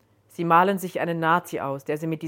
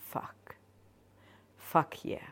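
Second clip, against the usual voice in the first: breathy whisper to herself; 10 dB quieter than usual. Acted. Joan reacting to the scent of the men, whispered. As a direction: hush it out under your breath. Joan's aroused inner reaction.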